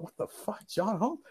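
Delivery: whispered, stunned aside; shocked whisper. Retold or imitated. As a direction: hiss it under your breath in disbelief.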